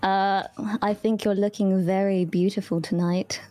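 smooth British accent flirting on request. Shown as a demonstration. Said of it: "Say something cool in British".

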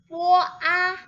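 loud, high po a; loud syllables. Shown as a demonstration. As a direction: call out po a. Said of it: "suaranya nyaring sekali". (bunyi po a yang keras)

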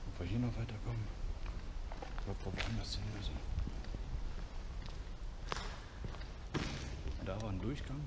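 hushed, tense whisper while exploring; nervous night vlog whisper. Real, not acted. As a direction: keep it whispered, wary of noise. Quiet exploring in derelict building.

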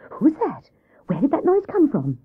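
a touch of alarm in the questions; mildly startled. Acted. Hare reacts to a sudden hoot, mildly startled.

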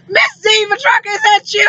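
high, excited call across the store; a fan who spots her, thrilled. Retold or imitated. Fan recognizing her at a truck stop, excited call. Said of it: "How about he say".

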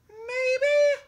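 high, tiny squeak of a mouse. Acted. Mouse answering from the hall, tiny and high. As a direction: peep it like a hiding mouse. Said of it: "came a tiny voice".

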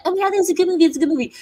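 high, loud mock gush of clueless viewers; mocking gushing fans. Mocking viewers who loved the movie, gushing high and loud. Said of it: "a whole bunch of people were like".

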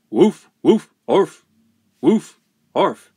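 short loud high barks; imitated woofs and arfs; dog barking. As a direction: bark like an excited dog. Dog answers the call.

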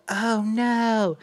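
high, dismayed mock cry; high-pitched "uh-oh" dread; retold alarm. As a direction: imitate someone watching disaster unfold. Retold owner sees dog get loose.

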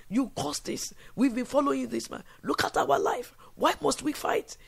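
high, loud bitter complaint; angry accusing crowd. Acted. David's men turn on him, loud and high in anger.

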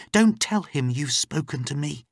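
high, urgent warning; lightly voiced worried woman; anxious captive woman. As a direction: plead for secrecy through the door. Captive warns Layla.

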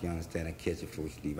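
ordinary delivery, nothing marked

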